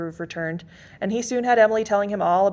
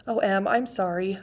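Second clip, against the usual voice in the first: ordinary delivery, nothing marked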